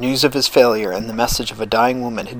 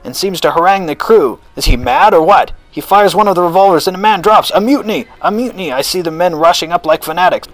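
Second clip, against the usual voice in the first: high, loud, breaking news as it happens; urgent commentary building to a cry; excited eyewitness report; 6 semitones higher, 4 dB louder than usual. A narrator's reading. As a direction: call out each event with mounting alarm.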